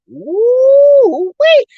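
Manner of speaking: high, drawn-out whoop of disbelief; hammed-up astonishment; shocked exclamation. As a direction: let out a long, loud, high ooh. Acting out own shocked reaction.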